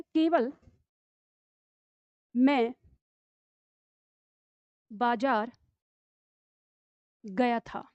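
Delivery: slow, with long pauses between words; slow dictation. Shown as a demonstration. Teacher dictating the sentence slowly to pupils. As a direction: dictate word by word for the class to write. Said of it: "जैसे मान लो, वाक्य है".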